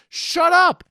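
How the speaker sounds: ordinary talk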